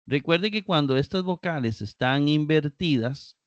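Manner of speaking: usual speaking voice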